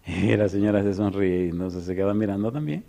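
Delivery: ordinary talk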